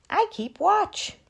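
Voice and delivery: high, lightly voiced crow; a hint of a watchful bird. A narrator's reading.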